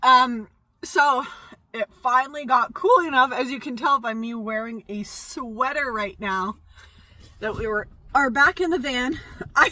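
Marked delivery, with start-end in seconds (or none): none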